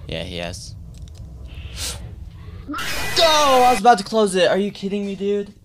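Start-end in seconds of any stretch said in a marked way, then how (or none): 0.8-3.1 high, sharp intake of breath; startled gasp. Real, not acted. Startled by game. 3.2-5.5 high-pitched yelp of disbelief; startled protest. Real, not acted. Caught just before closing door.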